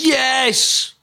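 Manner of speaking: high, drawn-out yes; excited cheer. Real, not acted. Cheers Bill and Ted news.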